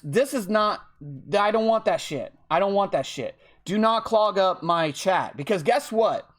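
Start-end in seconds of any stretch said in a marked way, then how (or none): none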